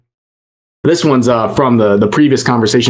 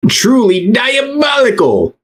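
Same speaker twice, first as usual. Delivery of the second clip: high, slow drawn-out shout; exaggerated outrage; 10 semitones higher than usual. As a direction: stretch it out, scandalized. Mock outrage exclamation.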